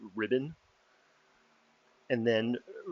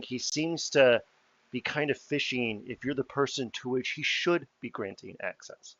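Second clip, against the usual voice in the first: ordinary talk, nothing marked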